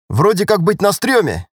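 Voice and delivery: high, lightly cheeky soldier voice; grinning private. A narrator's reading. Private answers lieutenant's orders, with a grin. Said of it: "ухмыльнулся рядовой".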